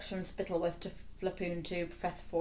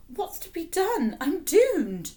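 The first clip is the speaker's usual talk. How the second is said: much higher, slow, despairing king; panicked croak; 11 semitones higher, 7 dB louder, slower than usual. A narrator's reading. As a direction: wail it as if already lost. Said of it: "Fred croaked".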